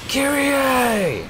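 high, drawn-out mock scream of a name; Nero yelling. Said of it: "inexplicably yelling her name".

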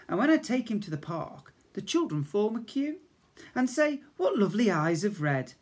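lively sing-song poem reading. Acted. Teacher reading a funny poem to Year One children, sing-song. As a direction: read it out bouncy, like a giggly rhyme.

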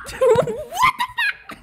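very high, drawn-out laughing squeal; overwhelmed squeal. Real, not acted. Fan in disbelief at how he sounds, overwhelmed squeal. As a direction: squeal it slowly through laughter.

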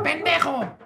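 high yelled insult from another driver; mock yell. Driver yells at him. (grito burlón de conductor enojado)

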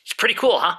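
ordinary talk, nothing marked

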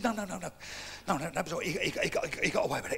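subdued acted reassurance; understated correction. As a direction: brush off the condolence quietly. Mate first corrects the assumption.

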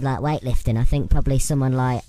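ordinary talk

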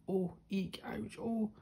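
little storybook cries, climbing in pitch; startled yelps. A narrator's reading. Mole slipping from the tree, startled yelps.

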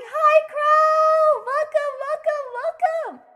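high squealed welcome, loud at first; excited greeting. Real, not acted. Streamer greeting a new arrival, excited welcome. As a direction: burst out high with the name.

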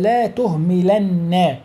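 لا تهملن very slow, doubled nun held; emphatic nun drawn out. Shown as a demonstration. Teacher gives an example to the class, slowly. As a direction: dwell on the نّ of تهملن. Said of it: "مثل".

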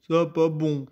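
ogre voice. Acted. Ogre speaking.

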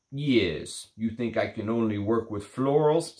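a lightly put-on, bad Russian accent; mock the perfumer in a clumsy Russian accent; terrible Russian accent. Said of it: "Sorry for my terrible Russian accent".

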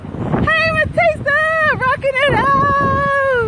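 high, drawn-out gleeful cheer; excited call-out. Real, not acted. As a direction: sing out a hello to the coach, long vowels. Greets coach while walking, high and drawn out.

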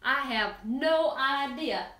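normal talking voice

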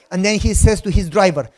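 ordinary talk, nothing marked

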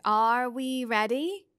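slow, drawn-out eerie voice; slow otherworldly voice. Acted. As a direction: stretch each word like an ancient being. Missus Which speaks, eerie figure.

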